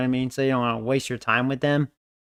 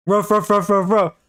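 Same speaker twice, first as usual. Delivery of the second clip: dog barking; 6 semitones higher, 4 dB louder than usual. Retold or imitated. Dog going off on him.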